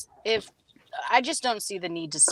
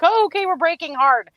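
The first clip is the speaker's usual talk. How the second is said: startled; 7 semitones higher, 6 dB louder than usual. Retold or imitated. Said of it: "I'm like".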